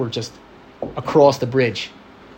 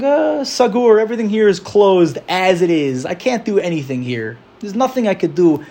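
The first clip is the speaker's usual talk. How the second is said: high, whining hopelessness; despairing lament; 4 semitones higher than usual. Retold or imitated. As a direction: moan that everything is closed. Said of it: "and we think".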